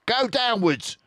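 high, loud command; commanding fox; order. Acted. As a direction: give the order. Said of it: "ordered Mister Fox".

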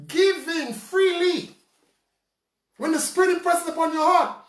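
impassioned preaching. Acted. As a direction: preach it out to the congregation. Preacher raises pitch, full force.

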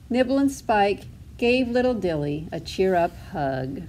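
ordinary delivery

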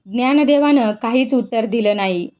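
plain reading voice

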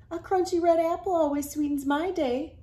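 lightly voiced apple grower; sweet baker. Voicing Cinnamon Cobbler.

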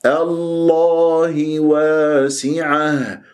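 slow chant, open unrounded aa in الله واسعة; corrected open vowels. Shown as a demonstration. Teacher corrects the vowel of الله, slow open chant. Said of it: "لا".

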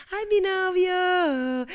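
suspiro alargado y alto de enamorada; ñoña enamorada. Acted. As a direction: derrite cada sílaba como una adolescente colgada. Enamorada suspirando por su novio. (suspiro de enamorada, agudo y muy lento)

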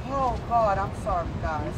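apologising as the voice gives way; breaking down. Real, not acted. A grieving mother apologising to the crowd as she breaks down. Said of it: "Just take your time".

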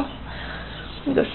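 usual speaking voice

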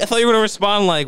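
ordinary talk

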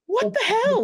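high-pitched retold disbelief; mock shock. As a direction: imitate a man caught off guard. Kenny shocked surgery already started.

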